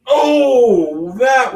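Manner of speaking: loud, high amazed oh; excited exclamation. Real, not acted. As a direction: cry out in awe. Reaction to a cool spot.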